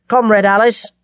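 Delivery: firm greeting with some force; brisk military formality; curt, stern. A narrator's reading. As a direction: address a comrade sternly, insisting on standards. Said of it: "His voice was curt, stern and pure".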